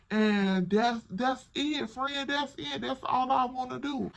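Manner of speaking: put-on tipsy friend voice; mock drunken brush-off. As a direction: imitate a drunk friend insisting all is fine. Voicing drunk friend brushing others off.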